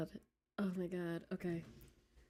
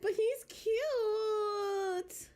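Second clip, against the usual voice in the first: much higher, very slow fake sob; mock crying whine; 13 semitones higher, much slower than usual. Streamer pleading to chat about cute villain, mock sobbing whine. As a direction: whimper it like a pouting crybaby. Whining about choosing Vice.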